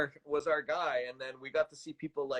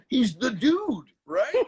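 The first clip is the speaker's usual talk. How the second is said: loud, higher-pitched movie slacker impression; Jeff Bridges; 6 semitones higher, 9 dB louder, slower than usual. Shown as a demonstration. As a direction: belt it out as a laid-back film dude. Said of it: "a pretty decent Jeff Bridges".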